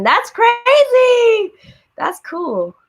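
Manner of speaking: drawn-out high amazed exclaim. Real, not acted. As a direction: stretch it out in disbelief. Reacting to surprising viewer fact.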